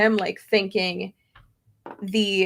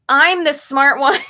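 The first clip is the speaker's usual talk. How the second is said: slow and louder, breaking into a laugh; laughing boast; 4 semitones higher, 8 dB louder than usual. Real, not acted. Telling viewers she's the smart one, laughing loudly. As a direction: tease him through a laugh. Joking about Tony explaining.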